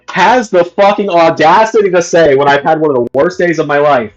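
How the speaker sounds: normal talking voice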